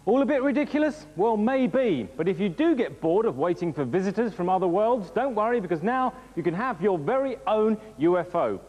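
high, bright sales pitch voice; ad read. Acted. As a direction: sell it like a TV advert. Hyped gadget advert read.

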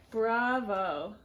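ordinary talk, nothing marked

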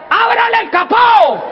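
high, slow yelled order; shouting. Retold or imitated. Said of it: "ellas gritan". (mujer gritando la orden)

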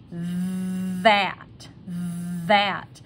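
"That" with a long buzzing th; voiced TH. Shown as a demonstration. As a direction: hold the voiced th opening "That". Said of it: "listen to the T H when I say this word".

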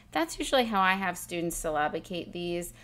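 normal talking voice

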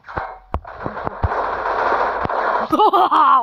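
breathy laugh that runs on; long wheezing laugh. Real, not acted. Laughing at the game.

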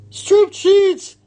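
very high, loud shriek of disbelief; Missus Rogers exclaiming. A narrator's reading. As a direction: exclaim in shocked horror. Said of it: "exclaimed Missus Rogers".

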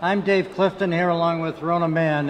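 usual speaking voice

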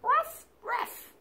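high yappy puppy bark; dog bark. Shown as a demonstration. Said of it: "the doggies go like this".

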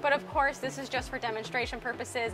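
ordinary delivery

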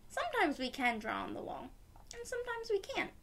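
plain reading voice

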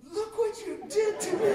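high, loud imitation of mom's outrage; mock accusing yell. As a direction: yell it as an outraged mom, half laughing. Imitates mom confronting the man.

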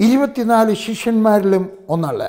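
ordinary delivery

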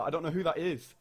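usual speaking voice

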